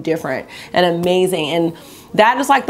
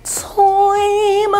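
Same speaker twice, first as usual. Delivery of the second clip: slow, higher, hammed-up dignified plea; mock formal statement; 5 semitones higher, slower than usual. Vlogger overcome by her blue look, mock press plea. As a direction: play the overwhelmed celebrity.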